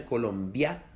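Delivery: Colombia with lia as one syllable, the i gliding into the a; correct Colombia. Shown as a demonstration. Teacher models the right pronunciation, gliding the vowels. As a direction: say Colombia with the final vowels run together in a single syllable. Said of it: "se pronuncian Colombia".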